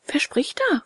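high, timid question; trembling question. A narrator's reading. Puppet questioning a voice in the dark, trembling. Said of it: "fragte Bengele und zitterte".

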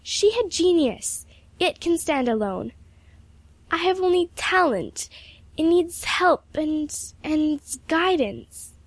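put-on high, slow, halting girlish plea; protesting. A narrator's reading. Pupil protesting she lacks genius, high and earnest. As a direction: plead your case, pitch well up. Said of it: "protested Emily".

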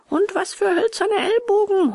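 high, whining schoolboy wail; acted boyish howl of pain; howling complaint. A narrator's reading. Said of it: "heulte ein anderer".

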